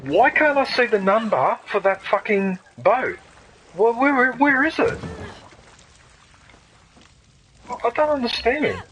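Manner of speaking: loud, high, exasperated yelling; frustrated shouting. Real, not acted. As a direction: yell it out at the game, fed up. Gamer furious at missing number.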